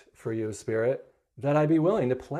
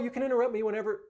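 quick; 9 semitones higher, much faster than usual. Real, not acted. Takes back own words.

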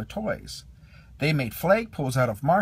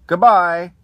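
loud goodbye; 4 semitones higher, 13 dB louder than usual. A narrator's reading. Child says goodbye to toys.

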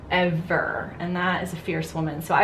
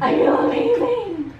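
high laughing repeat; laughing echo; 6 dB louder than usual. Real, not acted. Echoing client's words, laughing.

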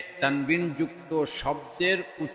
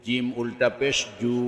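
final "জু" held long; drawn-out vowel; 3 dB quieter than usual. Shown as a demonstration. Teacher demonstrating ulta pesh, long "জু". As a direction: stretch the last "জু" for one alif. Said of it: "একালিপ টেনে পড়তে".

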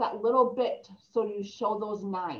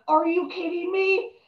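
disbelief; 8 semitones higher, 4 dB louder than usual. Retold or imitated. Realising who it was.

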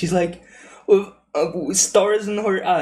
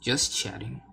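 ordinary talk, nothing marked

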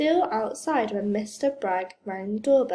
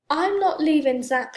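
raised, insistent refusal; loud, higher-pitched insistence; stubborn refusal; 5 semitones higher, 5 dB louder than usual. A narrator's reading. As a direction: dig your heels in. Old lady refuses to go.

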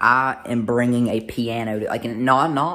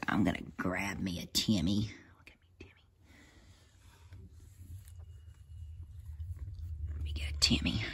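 soft unvoiced whisper to the kittens; 5 semitones lower, 12 dB quieter than usual. Real, not acted. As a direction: whisper so the kittens stay calm. Whispering near sleeping kittens.

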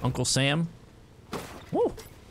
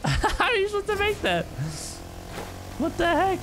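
laughing disbelief. Real, not acted.

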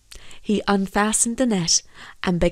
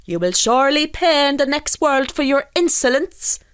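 high-pitched scolding threat; indignant threat; 7 semitones higher, 4 dB louder than usual. A narrator's reading. Fisherman threatens the escaped fish, indignant. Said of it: "called out Michael as he shook his fist".

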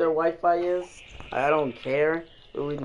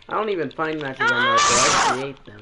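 boastful shout; 7 semitones higher, 4 dB louder than usual. Real, not acted. Boastful trash talk, shouted.

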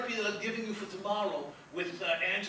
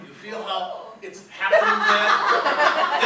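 ordinary talk, nothing marked

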